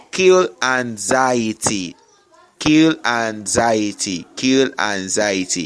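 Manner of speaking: Kill anxiety repeated slowly with weight on kill; slow insistent repetition to drive it home; slow repeated command. Shown as a demonstration. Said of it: "learn to kill anxiety".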